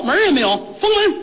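sharp high call to seal the door; sealing order. Acted. Officials order the shop sealed, calling out high. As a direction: bark the order to shut the shop.

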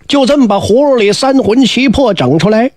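ordinary delivery, nothing marked

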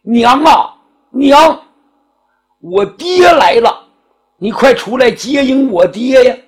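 put-on boy shouting for his mother to come out; calling out to mother. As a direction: call out for mom, slow and pleading on the last bit. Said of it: "这孩子就喊呐".

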